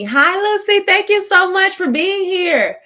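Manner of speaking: high, loud and slow, gushing hello to a viewer; high, loud, slow welcome for a viewer who just joined; excited greeting. Real, not acted. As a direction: greet her like she made your day.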